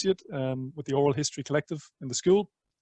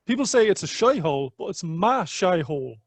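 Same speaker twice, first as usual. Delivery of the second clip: Dublin accent; 3 semitones higher, 5 dB louder than usual. Shown as a demonstration. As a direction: put on a Dublin accent, lifted. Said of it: "I'm gonna do it in a Dublin accent".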